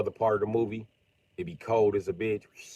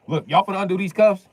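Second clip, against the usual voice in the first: high, loud cocky demand; 9 semitones higher, 10 dB louder than usual. Acted. Sniper demanding police remove his cuffs, loud. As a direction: tell them to take the cuffs off.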